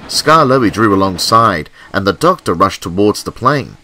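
ordinary delivery, nothing marked

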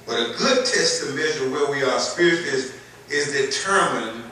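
rising preacher's cadence, building loud; preaching. Acted.

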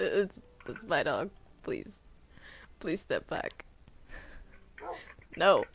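normal talking voice

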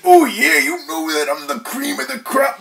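hammed-up Macho Man voice; Macho Man impression. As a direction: cut a wrestling promo. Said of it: "I turned into Macho Man Randy Savage".

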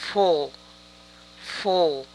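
"full" with short lax u, as in "put"; correct pronunciation. Shown as a demonstration. Teacher correcting learners, "full" with lax u. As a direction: say "full" short and open, not like "fool". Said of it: "tienen diferentes pronunciaciones".

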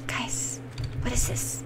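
soft unvoiced whisper; hushed whisper. Real, not acted. Player spotting hogs, whispering to viewers. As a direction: whisper so the animal won't hear.

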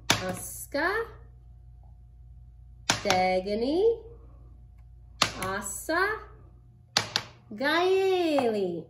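playful high counting; kids show host. Acted. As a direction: call each out like a TV host.

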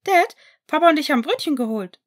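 put-on high, loud little boy shout; excited child call. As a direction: call out proudly like a small kid. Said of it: "rief Elliot".

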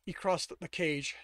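plain reading voice

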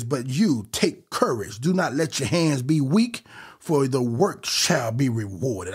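scripture reading. Acted.

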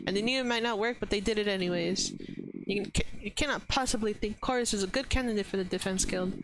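ordinary delivery

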